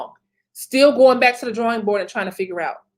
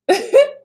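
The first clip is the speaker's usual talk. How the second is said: high burst of laughter; 13 semitones higher, 4 dB louder than usual. Real, not acted. Laughing at donut temptation.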